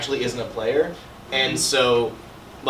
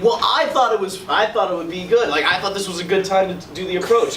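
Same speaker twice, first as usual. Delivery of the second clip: arguing with dad; 7 semitones higher, 5 dB louder than usual. Retold or imitated. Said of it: "he starts arguing with his dad".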